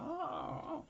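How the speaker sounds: very high, soft imitated whimper; bear moaning. Bear pleading for help, high whimpers. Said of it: "han hecho un gemido".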